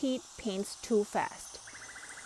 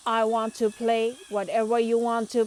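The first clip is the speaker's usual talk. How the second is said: loud, proud robot boast; robot bragging, chest out; 2 semitones higher, 6 dB louder than usual. A narrator's reading. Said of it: "Robo Pete says proudly".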